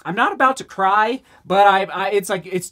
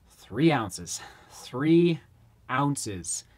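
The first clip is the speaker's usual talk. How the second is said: low, soft, reverent repeat; savoring hush; 6 semitones lower, 5 dB quieter, much slower than usual. Real, not acted. Runner savoring the shoe's weight, low and hushed. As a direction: breathe the number like a secret.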